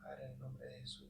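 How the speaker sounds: re-enacted prayer, barely audible; murmured prayer. Passenger praying to the Father during spiritual oppression, murmured. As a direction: pray under your breath, almost whispering. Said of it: "y empiezo a orar".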